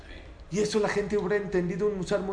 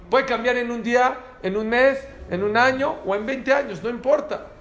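loud, high, emphatic list; insistent raised-voice sermon delivery; full-force preaching; 6 semitones higher, 6 dB louder than usual. Acted. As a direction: raise the voice and drive each item home.

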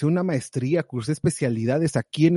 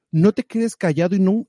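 ordinary talk

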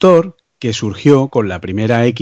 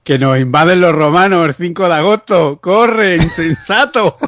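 alarm shout; 8 semitones higher than usual. Pretend. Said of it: "Y se ríe el tío".

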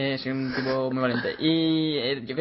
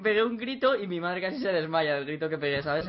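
usual speaking voice

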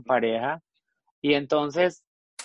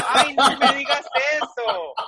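much higher and louder, laughing denial; laughing protest; 11 semitones higher, 8 dB louder than usual. Retold or imitated. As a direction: squeal it through laughter, embarrassed. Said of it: "Y le digo".

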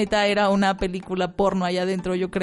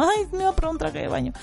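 a bit higher, quick flustered excuse; embarrassed excuse; 7 semitones higher, faster than usual. Retold or imitated. Naked girl caught in the fitting room, quick flustered excuse. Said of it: "así como".

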